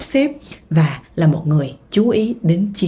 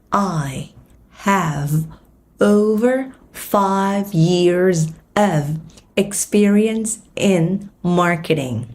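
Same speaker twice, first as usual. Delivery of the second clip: slow, each word separated; slow drill for learners; much slower than usual. Shown as a demonstration. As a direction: break it up to drill it. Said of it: "Nhấn âm một trong từ marketing nha".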